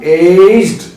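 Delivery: एज्ड said as one drawn-out long-A syllable ending in d; demonstrated pronunciation. Shown as a demonstration. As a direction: model एज्ड slowly for learners. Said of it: "इसको बोलेंगे हम".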